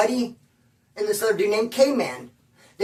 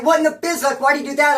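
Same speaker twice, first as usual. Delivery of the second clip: exasperated bafflement; 6 semitones higher, 4 dB louder, faster than usual. Retold or imitated. Baffled that Eric left anyway.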